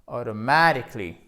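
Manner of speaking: AUTOMATICALLY with a punched stress on MA; accent tonique. Shown as a demonstration. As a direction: hit the MA in "automatically", quick light ending. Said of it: "Accent tonique primaire". (automatically, accent tonique appuyé sur MA)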